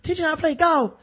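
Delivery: high kiddy impression; old Junior voice. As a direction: whine like an eager little boy. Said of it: "the old Junior was just like".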